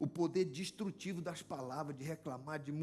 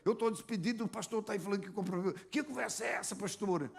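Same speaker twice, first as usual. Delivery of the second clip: higher, louder indignant complaint of a fired woman; angry protest; 4 semitones higher, 4 dB louder than usual. Retold or imitated. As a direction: snap back at the pastor, outraged. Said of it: "E, e ficou brava demais". (protesto indignado, mais agudo e mais alto)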